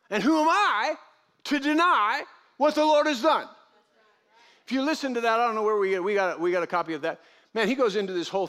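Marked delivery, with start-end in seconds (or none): 0.1-3.5 higher and louder, ringing preacher cry; preaching at full force. Acted. Preacher proclaiming the Lord's work to the congregation, full force. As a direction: proclaim it to the rafters.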